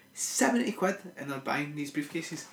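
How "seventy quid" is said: pitched way up in disbelief, seventy quid; can't believe they pay seventy quid; incredulous. Real, not acted. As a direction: scoff at the price, voice jumping high. Incredulous repeat of price.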